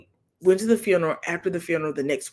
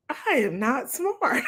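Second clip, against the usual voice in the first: slow, higher mock daughter lament; rueful daughter; 7 semitones higher, slower than usual. Daughter admitting she isn't smart, slow and higher. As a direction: let the daughter sigh out her realization. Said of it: "I'm like".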